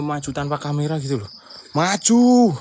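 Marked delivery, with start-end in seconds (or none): 1.8-2.5 loud call. Real, not acted. Calling out to the spirits.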